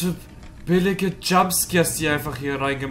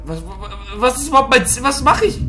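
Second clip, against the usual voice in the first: panicked; 8 semitones higher, 3 dB louder, faster than usual. Real, not acted. Panic in horror game.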